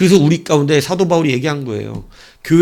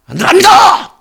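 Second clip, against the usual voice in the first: high loud refusal; stubborn military no; defiant yell; 7 dB louder than usual. Retold or imitated. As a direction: shout the refusal like a soldier. Refusing the senior soldier.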